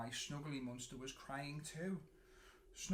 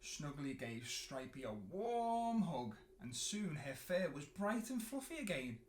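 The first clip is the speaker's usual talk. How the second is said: usual speaking voice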